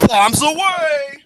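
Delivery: high, long-drawn call; mock battle cry. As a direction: holler it out, stretching the last word. Performs a call after throat clearing.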